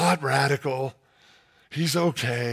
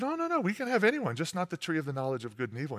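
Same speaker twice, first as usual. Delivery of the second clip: innocent Eve; 9 dB quieter, faster than usual. Acted. Eve answering the snake.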